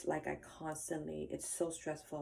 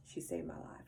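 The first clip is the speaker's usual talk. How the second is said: nearly whispered, softer with real emotion; choked up; 11 semitones lower, 7 dB quieter than usual. Real, not acted. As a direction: let the voice drop away as it catches. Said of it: "I get a little emotional about that".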